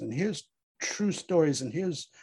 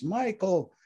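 high, desperate calling of his name; acted scream, louder on the second call; 7 semitones higher, 4 dB louder, slower than usual. A narrator's reading. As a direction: call after him, pitched well up. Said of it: "she screams".

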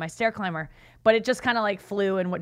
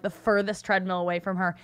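normal talking voice

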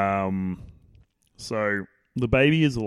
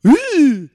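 mock scream; 15 dB louder than usual. Mock horror-movie scream.

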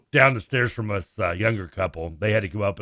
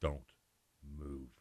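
low, hushed and much softer, barely voiced; hushed warning; 8 semitones lower, 18 dB quieter than usual. Mom hushing her son about the bat, quiet warning. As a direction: freeze him with a hushed warning. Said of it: "She's like".

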